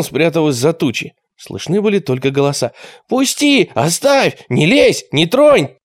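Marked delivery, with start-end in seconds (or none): 3.1-5.8 high, short exclamations; struggling cries. A narrator's reading. Captured boys protesting in the dark, crying out. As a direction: cry out to be let go.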